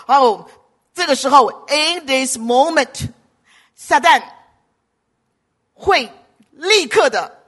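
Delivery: 响亮有力地讲道，层层推进; 向会众大声警告; 激昂讲道. Acted. Preaches about Satan's attacks. (牧师警告会众撒旦的攻击，激昂讲道)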